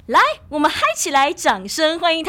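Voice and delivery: loud, hyped emcee welcome; hyped welcome call. Real, not acted. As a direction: pump up the crowd for the guest. Host calls for applause.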